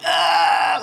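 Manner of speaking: a short strained "Ah!" in pain; mock pained cry. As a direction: imitate the choked guy crying out. Retelling the fight, tapping out.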